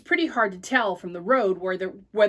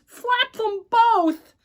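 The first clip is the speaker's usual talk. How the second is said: high, slow, exasperated shout; fed-up brother calling up high; 12 semitones higher, 6 dB louder, much slower than usual. Acted. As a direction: groan it out in frustration. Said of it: "I groaned".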